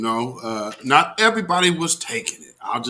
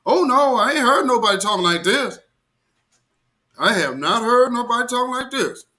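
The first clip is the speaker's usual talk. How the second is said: much higher, amazed and emphatic; raised, delighted disbelief; 8 semitones higher than usual. Real, not acted. Amazed at the speech.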